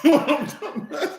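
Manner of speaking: breathless high giggle; high wheezy laugh. Real, not acted. Laughs at own joke.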